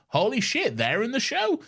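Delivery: high excited gasp over "Holy shit"; excited fan. Retold or imitated. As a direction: squeal it like a starstruck fan. Excited fan reaction quoted.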